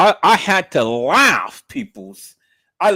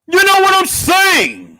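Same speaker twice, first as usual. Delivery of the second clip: high, loud catchphrase parody; mocking shout; 8 dB louder than usual. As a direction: holler it high, louder the second time.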